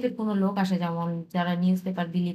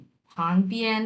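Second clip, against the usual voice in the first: very slow, one word at a time; slow piece-by-piece Chinese; broken into pieces; much slower than usual. Shown as a demonstration. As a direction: read it in small chunks. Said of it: "একটু ভেঙে ভেঙে পড়ি".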